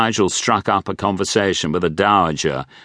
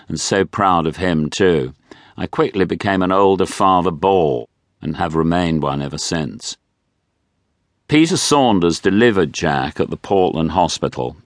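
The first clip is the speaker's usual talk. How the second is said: usual speaking voice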